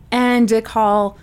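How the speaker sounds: usual speaking voice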